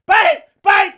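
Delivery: put-on high, loud panicked shouting to the girlfriend; retold screaming. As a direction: call upstairs in alarm. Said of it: "So I'm, like, screaming".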